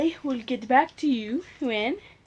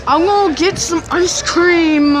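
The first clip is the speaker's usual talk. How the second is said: slow, raised, insistent; louder insistent repeat; 4 semitones higher, 5 dB louder than usual. Real, not acted. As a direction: insist on the ice cream, drawn out.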